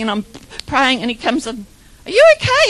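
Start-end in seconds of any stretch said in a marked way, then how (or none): 2.1-2.7 high, loud imitation of a concerned dentist; acted-out startled check-in. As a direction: pitch it way up like someone walking in alarmed. Acts out startled dentist walking in.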